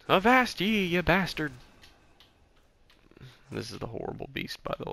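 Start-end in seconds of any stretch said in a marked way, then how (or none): 0.1-1.5 loud, high pirate bellow; old sailor. Pretend. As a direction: roar it like a salty old sea dog. Pirate shout at the boss.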